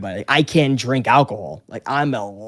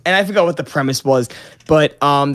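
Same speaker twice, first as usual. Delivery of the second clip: ordinary talk, nothing marked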